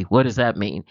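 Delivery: irritated stranger. Retold or imitated. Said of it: "He wasn't happy".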